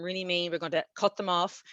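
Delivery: ordinary talk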